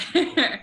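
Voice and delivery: bright burst of laughter; quick amused laugh; short real laugh. Real, not acted. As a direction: laugh it off lightly. Laughs after remark.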